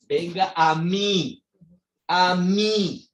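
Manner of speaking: slow, insistent invitation; emphatic calling. Retold or imitated. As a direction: stretch the words out to summon them. Acting Jesus calling the thirsty.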